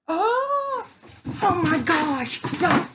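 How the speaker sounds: high squeal, then a loud slow oh my gosh; gushing over a crochet pattern; excited squeal. Real, not acted. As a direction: squeal with delight at the find. Excited reaction to a yarn pattern.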